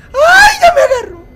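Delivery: high, loud panicked shriek; hammed-up scream. Streamer thinking the witch grabbed him, loud high shriek. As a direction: scream like you've just been grabbed.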